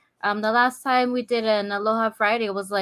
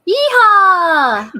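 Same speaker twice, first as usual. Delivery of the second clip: loud, high yeehaw holler; exuberant whoop; 7 semitones higher, 11 dB louder than usual. Real, not acted. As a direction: let out a big cowgirl whoop. Co-host whoops her hello.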